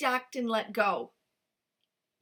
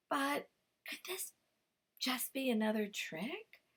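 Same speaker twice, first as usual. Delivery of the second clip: suspicious whisper; 3 semitones higher, 6 dB quieter than usual. Acted. Fox wondering, suspicious.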